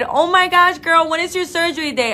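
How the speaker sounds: high, loud gushing question; excited. Real, not acted. Host asking a viewer about her surgery date, thrilled. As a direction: gush like a thrilled best friend.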